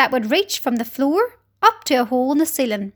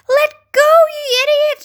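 shouting; 14 semitones higher, 6 dB louder than usual. A narrator's reading. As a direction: yell angrily to be released, pitch way up. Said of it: "shouted the centipede".

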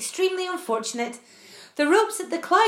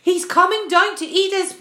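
higher, louder frightened wail; 5 semitones higher, 6 dB louder than usual. Acted. Old grasshopper wailing at the approaching giant, loud. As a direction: wail it out in panic. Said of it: "wailed the old green grasshopper".

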